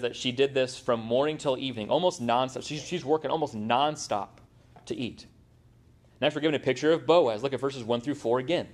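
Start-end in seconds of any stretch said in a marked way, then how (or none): none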